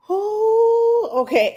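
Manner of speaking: high startled ooh, then a resigned okay; a jolt of alarm then giving in; squeaky ooh of dismay. Retold or imitated. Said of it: "I'm like".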